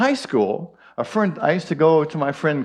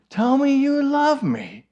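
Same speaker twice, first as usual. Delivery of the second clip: begging woman; 11 semitones higher than usual. Acted. Woman begs the parrot.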